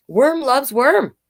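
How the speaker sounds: slow, high, announcing the book title; title read aloud. Acted. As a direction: draw out the title for the kids.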